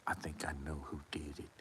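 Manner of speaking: fast low voiceless whisper; secret whisper. Retold or imitated. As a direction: confide it like a dangerous secret. Coma confides a suspicion.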